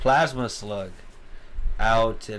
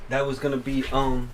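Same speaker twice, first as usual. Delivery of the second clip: normal talking voice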